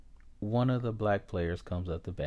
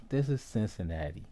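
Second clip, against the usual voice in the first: usual speaking voice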